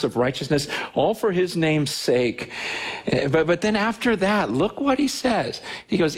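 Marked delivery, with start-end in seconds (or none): none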